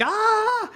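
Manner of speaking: sudden high yelp of alarm; startled yelp. Retold or imitated. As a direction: yelp as if caught off guard. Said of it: "And I was like".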